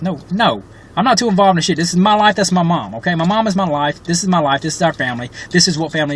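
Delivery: furious at the doctors, voice climbing; real anger. Real, not acted. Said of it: "I'm just very angry".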